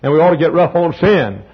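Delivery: ordinary delivery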